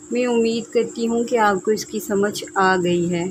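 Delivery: usual speaking voice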